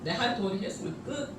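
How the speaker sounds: quiet conclusion. Acted. Preacher quietly sums up her point, soft and low. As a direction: settle 내 할 도리 했으면 끝 down, quiet and low, 할 rising gently.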